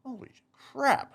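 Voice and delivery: a thrilled, jumping-up shout of holy crap; excited holy crap. Retold or imitated. Sees the big pay.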